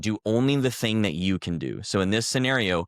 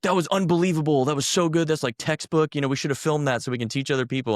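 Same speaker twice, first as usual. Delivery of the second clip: excited praise; 8 semitones higher than usual. Retold or imitated. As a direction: imitate the cadre raving about a textbook run. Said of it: "leading the thing was like".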